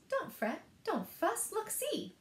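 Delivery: high, squeaky soothing; mouse squeaks. Acted. Mouse comforts the crying bear, high squeak. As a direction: coax the bear in a tiny high voice. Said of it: "Mouse squeaks".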